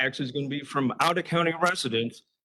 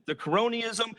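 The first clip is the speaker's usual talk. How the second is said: ordinary delivery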